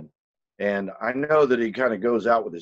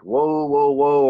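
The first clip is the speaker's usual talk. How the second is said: louder, rising alarmed whoas; startled whoa; 3 semitones higher, 5 dB louder, much slower than usual. Retold or imitated. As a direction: pull up short, a startled warning to himself. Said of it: "So I'm like".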